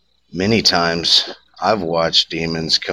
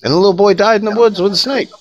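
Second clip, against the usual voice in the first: high, loud, rushed punchline; dramatic ending; 5 dB louder, much faster than usual. A narrator's reading. Storyteller finishing the tale for the campfire, high fast punchline. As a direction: blurt out the grim ending fast.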